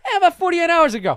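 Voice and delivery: high, loud mock confession; mimicking a sheepish admission. Host mimicking the guest admitting a recent outburst, mock confession. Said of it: "He's doing this whole thing".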